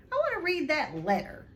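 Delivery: high, loud spoilt whine; loud demand. Acted. Spoilt son demanding the letter from his father, loud. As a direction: demand it loudly like a brat. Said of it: "he said loudly".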